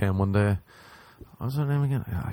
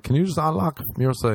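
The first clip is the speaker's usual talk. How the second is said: raised pitch, asking through a door; lightly imitated request for help; mild acted plea; 7 semitones higher than usual. Trapped man asks the women to unlock it, mild high plea.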